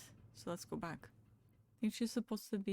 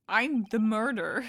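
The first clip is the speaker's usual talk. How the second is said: mock confession; 8 dB louder than usual. Joking confession in game.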